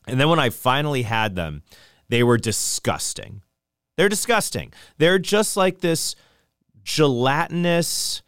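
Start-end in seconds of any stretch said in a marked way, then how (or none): 4.0-8.2 disgusted. Real, not acted. Disgusted exclamation.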